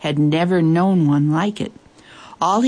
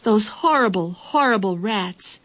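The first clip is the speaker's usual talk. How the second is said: embarrassed dismay; 4 semitones higher than usual. A narrator's reading. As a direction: draw out the horror, mortified. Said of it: "turning bright red with embarrassment".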